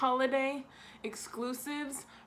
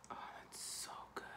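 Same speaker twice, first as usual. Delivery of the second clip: breathy whisper; 17 dB quieter, faster than usual. Real, not acted. Smelling the lip scrub.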